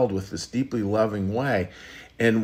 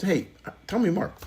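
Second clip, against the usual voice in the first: inviting demo; 8 semitones higher than usual. Shown as a demonstration. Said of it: "just try it".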